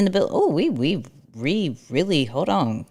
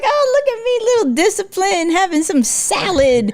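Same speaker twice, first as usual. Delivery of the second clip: high-pitched playful showing off; mock self-satisfaction; 11 semitones higher than usual. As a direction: act proudly virtuous in a high voice. Mocking herself acting virtuous about her diet.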